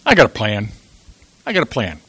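low, hushed voice of God; quiet confiding. Acted. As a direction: confide it softly, pitched well down. God quietly confides plan to Moses.

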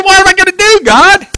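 high-pitched exasperated plea to God; frustrated. Acted. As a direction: cry out for help, pitch way up. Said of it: "He gets kind of frustrated".

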